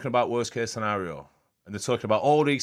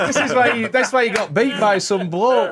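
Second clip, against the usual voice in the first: loud teasing through laughter; laughing; 9 semitones higher, 9 dB louder than usual. Real, not acted. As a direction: laugh while mocking him. Teasing friend, laughing loudly.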